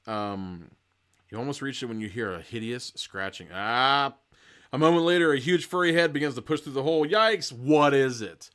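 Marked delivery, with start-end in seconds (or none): 3.5-4.2 short, loud "ah"; startled mock yelp. A narrator's reading. Reader hears hideous scratching, startled mock yelp. 4.7-7.5 loud, high dramatic reading; alarmed narration. A narrator's reading. Narrator reveals a furry head, loud mock alarm. 7.5-8.5 very loud, emphatic outcry; alarmed exclamation. A narrator's reading. Reader faces the furry beast, loud mock alarm.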